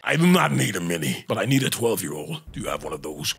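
Kratos voice. Acted. Holds Kratos voice.

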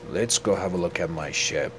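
usual speaking voice

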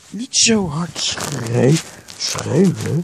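slow, drawn-out shouted reprimand; loud stretched-out telling-off; loud scolding. Acted. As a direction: shout down the screamer, stretching the words. Scolding someone for screaming.

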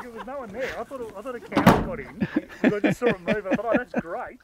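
ordinary delivery

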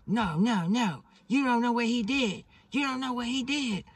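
very high, loud mock protest; shrieky retold outburst; imitated frantic woman.

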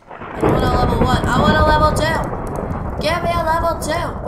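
loud, rising playful demand; insistent demand. Pretend. Demands a level from game.

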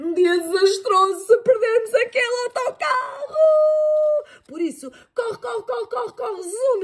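high, loud, hurried urging; high frantic hurry; panic. Acted. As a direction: urge her to run for the bus. Mother panics to catch bus.

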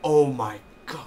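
loud, drawn-out oh my God; stunned fan reaction; amazed. Real, not acted. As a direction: let the shock spill out. Reliving shock.

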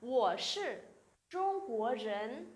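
slow, careful Mandarin answer; slow model reading. Shown as a demonstration. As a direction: spell it out slowly for learners. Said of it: "thay Trung Quốc vào nào".

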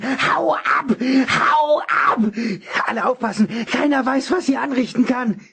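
high, desperate shouting warning; frantic, near-crying alarm; panicked ranting. A narrator's reading. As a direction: warn the whole hall in terror. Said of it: "brüllte er".

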